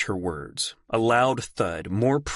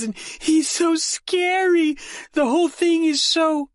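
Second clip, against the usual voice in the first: high, slow, louder fearful sobbing; frightened crying; 4 dB louder than usual. Acted. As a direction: push it out through tears. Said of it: "Now the tears were streaming".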